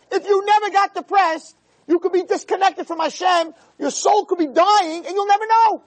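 full-throated pulpit cry; fiery preaching. Acted. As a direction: rise up and drive it home to the crowd.

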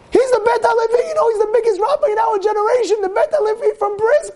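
very high-pitched, agitated exclamation; shocked outcry. Retold or imitated. Shocked bystander telling the owner who the lodger is, astonished outcry. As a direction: act out astonished alarm, pitched right up. Shocked revelation of the great rabbi.

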